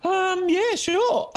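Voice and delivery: hesitant high yeah, sure; reluctant mock agreement; timid agreement. Reply to "can we still be friends.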